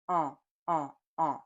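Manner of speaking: mm sound with some air kept open; half-blocked nasal hum; partly nasal vowel. Shown as a demonstration. Teacher shows the right, partly blocked nasal.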